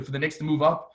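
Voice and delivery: usual speaking voice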